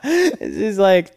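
laughing high and drawn out; real giggle. Real, not acted. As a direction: giggle through it. Laughing at own story.

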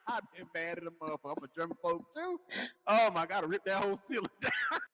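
high voice breaking into laughter; laughing indignation. Real, not acted. Reacting to the plunger story, real laughter.

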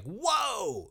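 high, drawn-out amazed whoa; surprised whoa. Real, not acted. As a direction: let it out, stunned. Reacting to the blunt remark.

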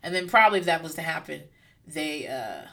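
normal talking voice

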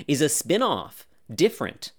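normal talking voice

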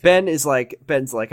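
ordinary talk, nothing marked

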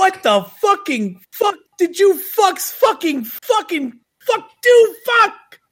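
high, slow shouted tirade; Rocco impression. Co-host quoting a movie outburst, high frantic shout. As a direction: screech each curse separately, exasperated. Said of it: "when Rocco burst into".

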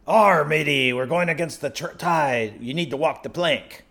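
a new waiter overdoing the pirate for his boss. Pretend. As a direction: play the stage pirate with gusto. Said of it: "still a little bit too piratey".

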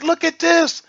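high-pitched retold astonishment; amazed excitement. Man showing brother his healed knee, high amazed excitement. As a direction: exclaim in wonder, voice jumping way up.

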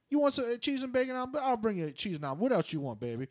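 high, loud, doting voice; imitating the room service lady. Retelling the hotel lady offering breakfast, high and doting. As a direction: coo at him like a fussy auntie. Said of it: "This woman definitely sounds like she's Korean".

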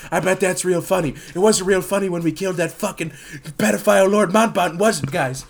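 wired, over-animated Uncle Joe, stumbling over apologies; hammed-up lively, aggrieved politician; Joe Biden impression. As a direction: rant like a hopped-up old politician. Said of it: "This is Uncle Joe after a few key bumps".

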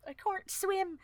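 high, softly piped mock panic; mock drowning cry. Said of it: "pretending to be afraid of drowning".

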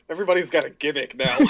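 amused retelling breaking into laughs; laughing. Retold or imitated. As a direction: crack up delivering the punchline. Quotes a line while cracking up.

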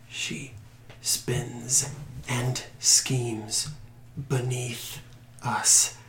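feeble, slow, drawn-out voice; barely getting the words out; weak voice. Acted. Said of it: "this weak voice".

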